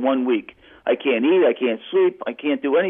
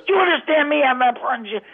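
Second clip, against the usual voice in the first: mobster; 12 semitones higher than usual. Retold or imitated. Mobster voiced on phone.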